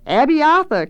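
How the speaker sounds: high, loud answering cry; high-pitched eager shriek; scream. A narrator's reading. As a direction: scream the name back as a chorus. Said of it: "came the scream again".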